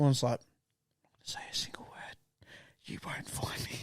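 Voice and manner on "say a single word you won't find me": hushed whisper from a hiding spot. Retold or imitated. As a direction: breathe it so nobody hears. Hiding kid whispers under bed.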